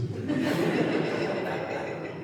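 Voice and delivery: high breathy giggle; real laugh. Real, not acted. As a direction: laugh at the memory. Laughing at own story.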